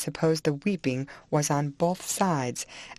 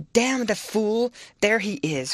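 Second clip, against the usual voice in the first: raised, exasperated curse; crying out; 6 semitones higher, 3 dB louder than usual. A narrator's reading. Lover hears the husband coming, crying out in anger. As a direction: curse higher, drawing it out. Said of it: "cried Heathcliff".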